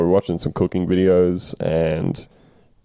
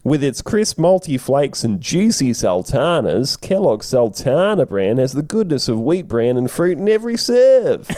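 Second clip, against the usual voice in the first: ad read; 5 semitones higher than usual. Acted. Reciting cereal ad copy.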